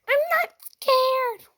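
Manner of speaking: high, loud, slow mock voice; mocking whiny voice. Mimicking the girl who fought her, whiny and mocking. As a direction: ham up the rival's whiny denial.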